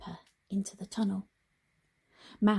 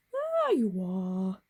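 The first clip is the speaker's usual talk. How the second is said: kindly voice; slower than usual. Acted. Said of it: "said the dragon in a kindly voice".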